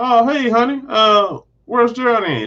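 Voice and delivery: high, light put-on greeting; casual husband greeting. Vision walks back in the house, high casual greeting. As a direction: imitate an unsuspecting husband, pitched well up.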